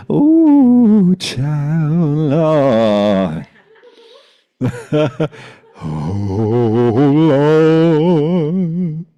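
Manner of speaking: long high wailed exclamation with wavering notes; theatrical dismay. Acted. Storyteller reacting to the cousin catching them, theatrical dismay. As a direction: drag it out loud, half-sung, breaking into a laugh. Dramatic reaction to being caught.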